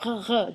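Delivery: "Re, re" with a guttural French R; gargled French R; throaty R. Shown as a demonstration. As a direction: growl "Re, re" from the back of the throat. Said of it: "you really get to work your throat".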